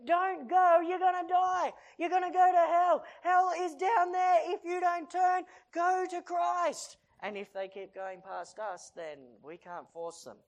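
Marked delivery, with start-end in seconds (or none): 0.0-7.0 shouting high and loud, near tears; desperate warning cry. Acted. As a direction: plead at full voice to turn them back. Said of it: "let the people know".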